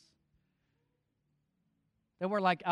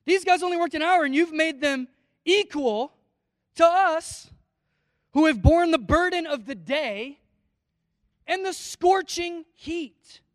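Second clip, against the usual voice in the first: higher, louder mock outrage; hammed-up worker complaining to the boss about equal wages; indignant grumbling; 7 semitones higher, 8 dB louder than usual. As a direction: play the workers protesting unfair pay. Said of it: "they grumbled at the master".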